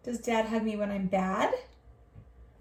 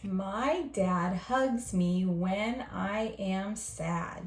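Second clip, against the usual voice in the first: slow, clear reading of each word; slow model reading; 3 semitones lower, much slower than usual. Shown as a demonstration. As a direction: read it slowly for the class to copy. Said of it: "Your turn".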